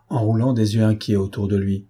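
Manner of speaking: ordinary delivery, nothing marked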